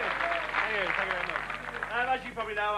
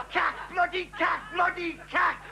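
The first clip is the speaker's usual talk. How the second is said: higher, shouting cack cack; ranting refrain; 6 semitones higher than usual. Acted. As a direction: bark it in disgust.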